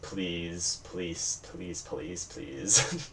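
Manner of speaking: soft, fading mumble of please and police; mumbled plea. Pretend. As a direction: barely get the words out, half conscious. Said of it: "you're kind of mumbling".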